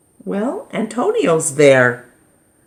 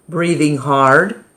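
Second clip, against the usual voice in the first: ordinary delivery, nothing marked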